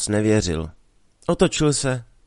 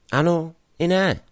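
evasive; 7 semitones higher than usual. Acted. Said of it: "řekl Jeník vyhýbavě".

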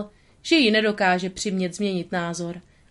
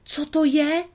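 frightened gasp; 8 semitones higher than usual. A narrator's reading. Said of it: "sýpala Lady".

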